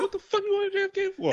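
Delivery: very high, incredulous imitation; hammed-up disbelief; mock outraged girlfriend. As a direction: shriek it like she can't believe it. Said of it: "You're like".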